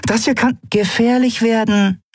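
very high-pitched, agitated appeal; urgent warning. A narrator's reading. Pilot warning her crew it could be dangerous, urgent.

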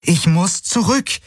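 high, slow, insistent plea; vehement repeat. A narrator's reading. Kirai insists to Hawks she must go back, high and slow. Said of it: "wiederholte sie und zeigte vehement".

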